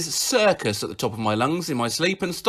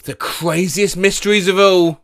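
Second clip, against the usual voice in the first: ordinary talk, nothing marked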